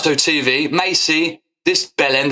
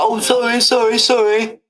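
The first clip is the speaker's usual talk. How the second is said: mocking apology; 7 semitones higher than usual. Mocking the streamer's feeble apology on live TV, high and whiny. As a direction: ham up a feeble grovelling sorry. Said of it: "But you're like".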